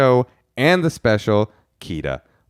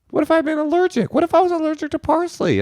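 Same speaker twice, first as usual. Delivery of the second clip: much higher, hammed-up panic over an allergy; mock alarm. Host reading an email aloud in hammed-up higher alarm. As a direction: ham up the horror.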